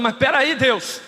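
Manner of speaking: high, slow, loud disbelief; incredulous protest. Acted. Preacher objecting to God about Babylon, loud disbelief. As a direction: push back in mock outrage, slowly.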